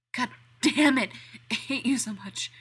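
loud strained outburst; exasperated curse. Acted. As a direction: spit it out, losing it. Danny cursing, losing control.

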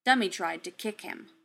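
ordinary delivery

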